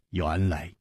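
ordinary delivery, nothing marked

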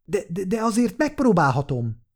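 high, halting stutter; stammering. A narrator's reading. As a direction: stumble over the first word, unsure. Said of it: "dadogta Likuva". (magas hangon dadogva)

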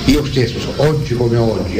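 ordinary talk, nothing marked

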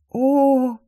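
high, airy exhaled "О!"; breathed-out oh. Acted. Girl hearing about free portals, breathed-out "О!". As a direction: let the "О!" escape on an astonished breath. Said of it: "выдохнула я".

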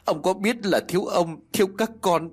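high, tearful pleading to a missing husband; choked lament. A narrator's reading. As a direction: cry out to him, voice catching. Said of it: "nghẹn ngào".

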